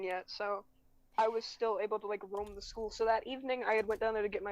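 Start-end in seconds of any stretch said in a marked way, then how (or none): none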